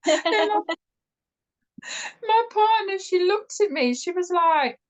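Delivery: high-pitched talk through laughter; helpless giggle pushing the voice up; laughing. Real, not acted. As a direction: fight to speak while cracking up. Cracking up while telling the story.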